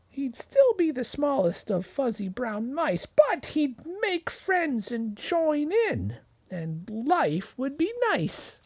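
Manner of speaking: dream of making friends; hopeful little mouse. Acted. Mouse continues.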